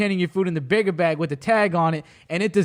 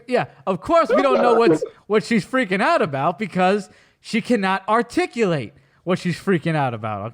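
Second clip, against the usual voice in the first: ordinary talk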